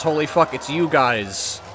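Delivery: ordinary delivery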